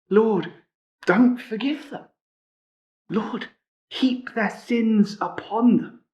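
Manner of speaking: a preacher voicing Nehemiah's angry prayer; imitating a vengeful prayer. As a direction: beg the Lord to punish them. Nehemiah's prayer against enemies.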